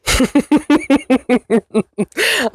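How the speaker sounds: long, high, breathy fit of laughter; real sheepish laughter; embarrassed laugh. Real, not acted. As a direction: laugh at yourself for rambling. Laughs at own slow storytelling.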